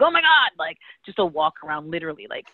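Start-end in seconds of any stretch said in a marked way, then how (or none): 0.0-0.5 high, loud "oh my God"; exasperated exclamation. Retold or imitated. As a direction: burst out in disbelief. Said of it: "And I'm like".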